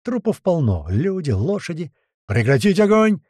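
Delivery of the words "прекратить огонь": loud, high commanding yell; shouted order. A narrator's reading. Tuman halts his men's shooting, yelling. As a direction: bellow the order to cease fire. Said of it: "начал орать Туман".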